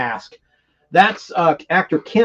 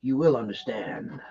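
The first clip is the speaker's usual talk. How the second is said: menacing villain; 5 semitones lower, 9 dB quieter, slower than usual. Retold or imitated. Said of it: "he's like".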